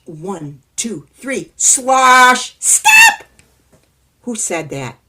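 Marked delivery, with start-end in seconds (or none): 2.6-3.2 much higher, louder tiny voice; little man shouts. Acted. Hidden little man shouting at neighbor, high tiny voice.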